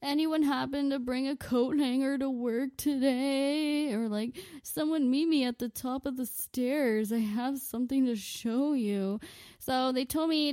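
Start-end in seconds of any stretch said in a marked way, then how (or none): 0.0-3.9 drawn-out, higher-pitched whine; exaggerated griping at coworkers; mock moaning. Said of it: "like, bitching and moaning". 4.6-9.2 slightly raised, put-on complaining voice; exaggerated grumbling; mock moaning. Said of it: "like, bitching and moaning".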